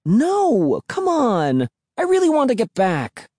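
high-pitched, whining plea; insistent protest. A narrator's reading. Emily argues to turn back.

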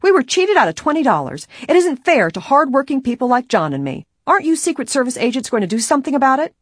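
loud, high-pitched complaint; upset protest. A narrator's reading. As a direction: protest loudly and indignantly. Woman complains to agents, upset.

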